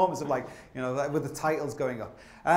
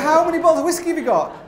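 high, loud, laughing disbelief; laughing mock outrage; 11 semitones higher, 8 dB louder than usual. To the friends hiding whiskey, laughing outraged shout. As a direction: yelp it out through laughter. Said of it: "be like".

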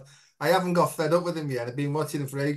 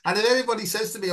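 ordinary talk, nothing marked

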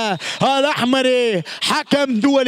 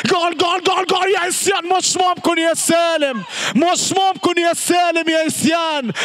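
goal call; 2 semitones higher than usual. Real, not acted. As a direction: roar the goal and can't believe it.

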